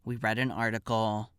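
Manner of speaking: ordinary delivery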